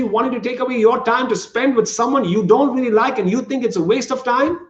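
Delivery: normal talking voice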